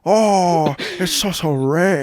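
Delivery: mocking the text with a loud fake accent; mock Chinese accent. Friend parodying the creep's DM, loud mock Chinese accent. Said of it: "He's doing an accent over text".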